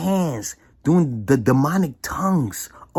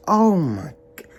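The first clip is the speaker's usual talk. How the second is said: choked cry rising to a high whoo; real distressed cry; 9 semitones higher, slower than usual. Real, not acted. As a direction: break down, then let it out. Overcome thinking of the children.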